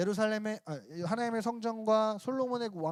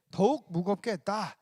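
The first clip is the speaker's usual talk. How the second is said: ordinary delivery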